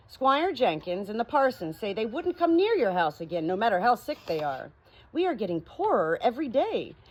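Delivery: lively storybook reading, rising and falling; story narration. A narrator's reading. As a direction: read it like a bedtime story to friends. Reads story aloud.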